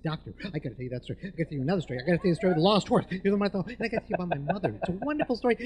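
rapid-fire, tumbling excited chatter; can't stop talking. Retold or imitated. Patient to doctor after getting voice back, rapid excited gushing. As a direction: gush on fast, rising in excitement. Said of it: "I couldn't stop talking".

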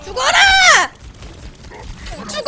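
loud high battle yell. Real, not acted. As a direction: shout to finish the enemy off. Fighting in game.

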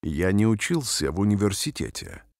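normal talking voice

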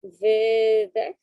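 loud, drawn-out call of exhale; loud exhale cue. Acted. Calls out exhale in practice.

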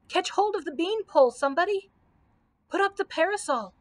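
high, alarmed shriek at moderate volume. A narrator's reading. Scarecrow shrieking orders as the party falls. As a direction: call out urgently, pitched well up. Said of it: "shrieked the Scarecrow".

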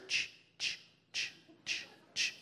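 whispered ch ch of a sprinkler; sprinkler noise. Retold or imitated. Describing the pasture sprinklers, imitated ch ch sound. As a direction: mimic a sprinkler hiss.

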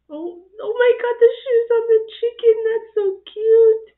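high, loud gushing over cuteness; delighted squeal. Real, not acted. Reacting to shoes on a chicken, delighted squeal. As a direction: squeal with delight at something adorable.

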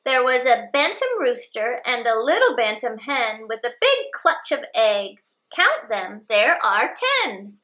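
ordinary delivery, nothing marked

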